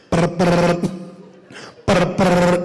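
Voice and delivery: trilled "brr" imitating a ringtone; imitated phone trill; phone ringing. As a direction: roll out two short phone rings. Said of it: "It's ringing".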